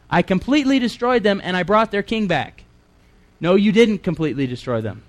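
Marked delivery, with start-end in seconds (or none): 0.1-2.6 mocking, high-pitched self-justification; mock Saul boast. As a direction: mimic a clueless claim, pitched up. Mockingly repeats Saul's claim.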